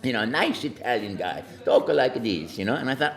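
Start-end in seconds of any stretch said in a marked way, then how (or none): none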